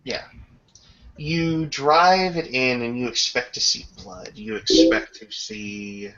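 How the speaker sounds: ordinary talk, nothing marked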